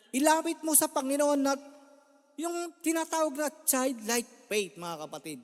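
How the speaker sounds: put-on preaching to the faithful about childlike faith; fervent preaching. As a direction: drive the point home to the congregation. Preaching rising.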